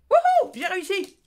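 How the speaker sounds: loud, high triumphant whoop; cheering. Real, not acted. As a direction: shout with victory. Triumph at remembering names.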